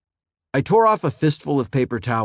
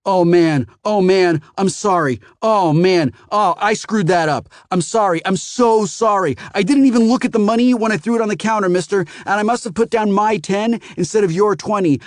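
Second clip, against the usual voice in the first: much higher, louder, rushed pleading; panicked apology; 10 semitones higher, 6 dB louder than usual. A narrator's reading. Scared teen apologizes to the furious customer, panicked.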